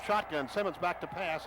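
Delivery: usual speaking voice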